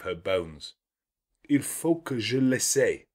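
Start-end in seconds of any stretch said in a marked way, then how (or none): 1.5-3.1 put-on lightly higher, eager little French girl; excited French girl. A narrator's reading. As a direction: cry it out as a thrilled child. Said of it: "cried she".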